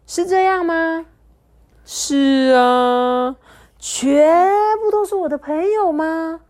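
high, wondering questions; amazed little girl. Acted. Dudu Xiang can't believe they are all her friends, high amazed. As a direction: ask in delighted disbelief.

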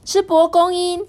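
very high loud hand-raising cry; eager queen. Acted. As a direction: shout I know like an overeager pupil. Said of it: "皇后就说".

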